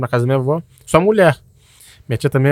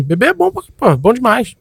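mock enthusiastic; 9 semitones higher, 3 dB louder, slower than usual. Mock praising drinking.

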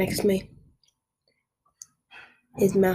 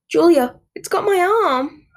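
high, loud cry for help; panicked yell; 10 semitones higher, 6 dB louder than usual. A narrator's reading. Marco to Julia as he is grabbed, yelling. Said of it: "Marco yelled".

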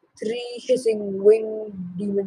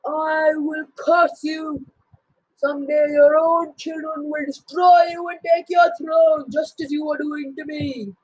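solemn curse; 7 semitones higher than usual. Acted. Father cursing his son as he falls, ominous. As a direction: lay a doom on your son.

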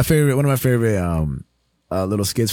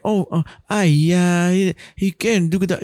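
Uncle Roger; 7 semitones higher, slower than usual. Acted. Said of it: "Uncle Roger ... has a crazy accent".

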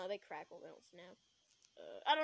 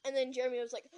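usual speaking voice

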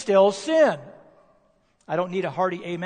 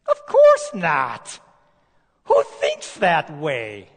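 slow, drawn-out, louder scoffing no; emphatic rebuttal; 3 semitones lower, 5 dB louder, much slower than usual. Acted. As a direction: dismiss the idea as absurd. Preacher answering a rhetorical question.

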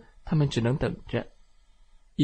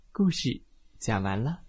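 usual speaking voice

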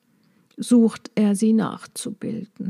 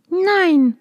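little bee voice; 9 semitones higher than usual. Acted. Maya answers the dragonfly.

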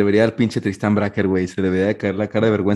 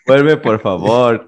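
mock medium plea; 5 dB louder, much slower than usual. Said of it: "Pero bien enojado".

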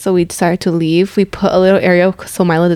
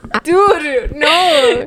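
words pushed through real laughter; laughing; 9 semitones higher, much slower than usual. Real, not acted. Telling sisters about the dog watching, laughing. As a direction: protest while cracking up, pitched higher. Cracking up retelling embarrassing story.

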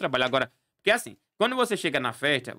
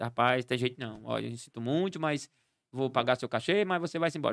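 apologetic dismissal; 6 semitones lower, 6 dB quieter than usual. Acted. Grandmother apologetically sends clown away.

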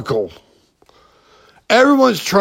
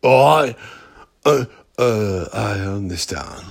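Sean Penn impression; 5 semitones lower than usual. Host imitating Sean Penn as a Hollywood poser, fading mock swagger. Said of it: "is that how Sean Penn is".

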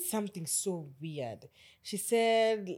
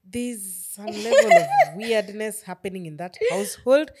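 laughing; 3 semitones higher, 16 dB louder than usual. Real, not acted. Laughing while telling.

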